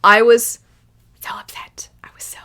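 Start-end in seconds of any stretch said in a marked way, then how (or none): 1.2-2.4 breathy whispered confession; hushed, unvoiced admission. Real, not acted. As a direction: drop to a whisper to show how upset. Confessing how upset she was.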